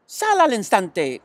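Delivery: angry mom calling her son out; mother shouts. A narrator's reading. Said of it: "gritó la señora Arable".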